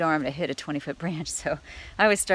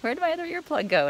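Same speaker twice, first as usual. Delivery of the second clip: high-pitched mock bewilderment; squeaky-high quoted wondering; puzzled retold question; 13 semitones higher, 5 dB louder than usual. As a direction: act out a baffled search, pitch jumping way up. Acting out waking puzzlement.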